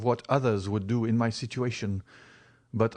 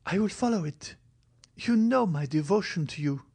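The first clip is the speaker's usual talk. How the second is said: much higher, insistent then tender; devoted girl; 8 semitones higher than usual. Acted. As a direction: urge him, then soften. Valentine begs his advice.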